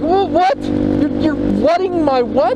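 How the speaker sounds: high squeaky "wha-, what?", then slowing in disbelief; stunned disbelief. Retold or imitated. To the doctor, retelling his stunned disbelief. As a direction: replay your own shock at the doctor. Retold reaction to doctor's news.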